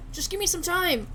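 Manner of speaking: ordinary talk, nothing marked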